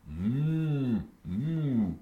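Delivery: soft lowing moo; cow moo. Acted. Father imitating a cow, soft moo.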